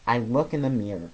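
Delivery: ordinary delivery, nothing marked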